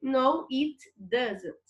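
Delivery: slow, clear short answer; teacher slowly models the answer. Shown as a demonstration. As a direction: lay out each word for the class. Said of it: "Se não tem passarinho".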